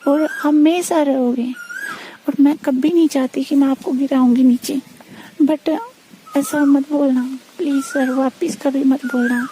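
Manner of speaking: ordinary talk, nothing marked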